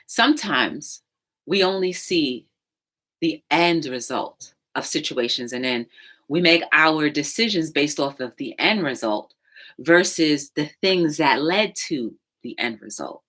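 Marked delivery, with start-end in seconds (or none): none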